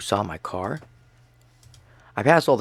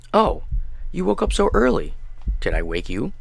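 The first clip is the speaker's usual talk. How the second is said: cheerful mother; 6 semitones higher than usual. Acted. Dead mother greets son cheerfully.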